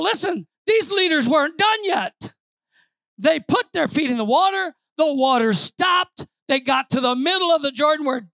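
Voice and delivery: high, driving preacher's narration; forceful preaching. Real, not acted. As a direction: build the miracle for the congregation.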